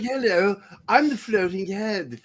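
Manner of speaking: lightly mock a pompous English floating head; mock pompous English accent. Mocking the disembodied head in a pompous English accent. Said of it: "Pompous English accent".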